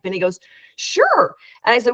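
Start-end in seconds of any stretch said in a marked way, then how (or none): 0.8-1.4 a bright, high and eager sure. Retold or imitated. Neil agreeing to come see the prototype, eager. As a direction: jump at the offer.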